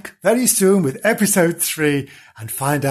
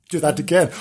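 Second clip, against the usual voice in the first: ordinary delivery, nothing marked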